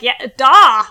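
a loud, mock Russian "Yeah, da"; loud imitation of Velma faking Russian; mock Russian yes. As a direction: bark "da" like a hammed-up Russian. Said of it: "she was like".

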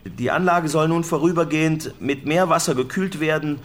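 calling the report out across the rooftop. Real, not acted. Rooftop correspondent raising his voice to the studio, loud. Reporter on roof, louder throughout.

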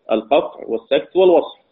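ordinary talk, nothing marked

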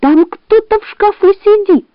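high, urgent fox voice; fox pleading. A narrator's reading. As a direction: shake him awake, voice up high. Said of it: "громко кричать".

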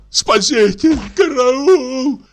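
high, loud, drawn-out scream; terrified cry for help. A narrator's reading. Drunk comic screams for help in terror.

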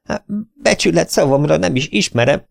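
high, plaintive pleading; whining lament. A narrator's reading. The agent whines to the sheriff, lightly voiced plaintive lament. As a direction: whine your innocence. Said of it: "siránkozott az ügynök".

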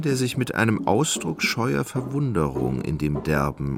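ordinary delivery

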